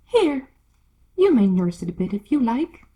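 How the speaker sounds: loud, offhand push; loud brusque Duchess. Acted. Duchess flinging the baby at Alice, loud. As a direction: shove it at her loudly.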